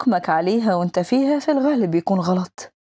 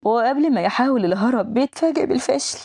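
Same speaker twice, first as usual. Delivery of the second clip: plain reading voice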